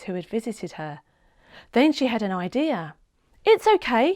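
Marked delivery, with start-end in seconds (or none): 3.4-4.1 put-on child voice. A narrator's reading. Child Seb speaking to lady.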